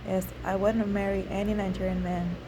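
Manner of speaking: ordinary delivery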